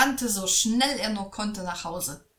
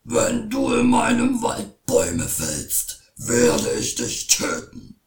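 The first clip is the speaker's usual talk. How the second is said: shouting troll; 9 dB louder than usual. A narrator's reading. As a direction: bellow the threat like a troll.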